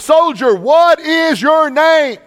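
high, loud, slow commanding yell; screamed demand. Retold or imitated. Alexander the Great screaming at the accused soldier, slow loud yell. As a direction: bellow the question like an angry general. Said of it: "He screamed out".

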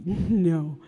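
usual speaking voice

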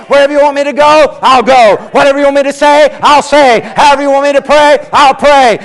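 preaching climax, ringing loud; rising shout. Real, not acted. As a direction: build to a full-voiced shout. Climactic surrender to God.

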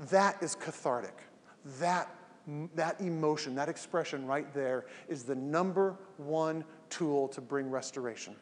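usual speaking voice

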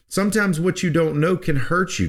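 usual speaking voice

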